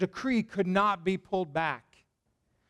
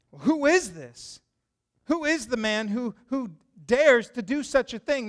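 louder, slowed demand from a proud king; angry king; 4 semitones higher, 6 dB louder than usual. Retold or imitated. King demands of Esther who threatens her, in a proud angry voice. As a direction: bark it out like an offended king. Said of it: "King Xerxes asked Queen Esther".

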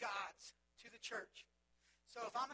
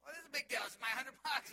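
indignant protest; 3 semitones higher, 11 dB louder than usual. Retold or imitated. Arguing with theater staff.

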